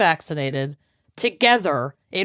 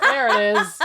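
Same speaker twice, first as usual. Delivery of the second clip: squeaky high voice; 11 semitones higher than usual. Pretend. Squeaky toad voice.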